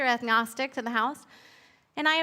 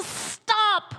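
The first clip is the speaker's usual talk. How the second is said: sudden high call that cuts everyone off; a new voice stops the arguing beings; commanding stop; 8 semitones higher than usual. Acted. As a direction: halt the whole quarrel in one word. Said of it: "a new voice spoke".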